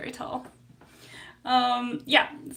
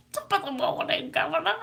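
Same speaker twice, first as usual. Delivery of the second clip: higher, hammed-up mock Cockney; Cockney accent; 5 semitones higher, faster than usual. Shown as a demonstration. Streamer greeting the governor, exaggerated Cockney accent. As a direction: lift it into a playful Cockney lilt. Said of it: "That's the Cockney accent".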